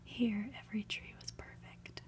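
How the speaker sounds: usual speaking voice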